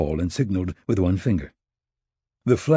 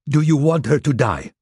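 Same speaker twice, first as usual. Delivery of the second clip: threat; 6 semitones higher, 4 dB louder than usual. Acted. Gadai threatens husband.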